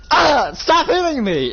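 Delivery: high, loud cry of pain and protest; pained yelling. Acted. Being punched again, he cries out high and loud. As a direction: yell high as the punches land.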